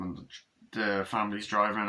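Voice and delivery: ordinary talk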